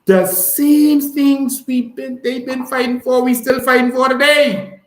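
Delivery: high, loud, impassioned cry; fiery shout. Real, not acted. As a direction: shout it out like a rally speech. Impassioned rant about land rights.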